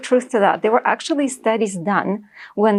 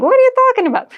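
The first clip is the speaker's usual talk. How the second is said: much higher, louder disbelief; incredulous; 4 dB louder, much faster than usual. Retold or imitated. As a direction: toss it up in amazed disbelief. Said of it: "and you're like".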